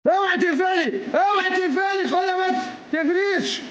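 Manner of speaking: high, yelling playful commands; excited shouting. Real, not acted. Prank, shouting at scared girl. (صراخ حماسي عالي)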